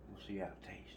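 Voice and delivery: hushed whisper to himself. Real, not acted. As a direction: whisper it under your breath. Tasting food quietly to self.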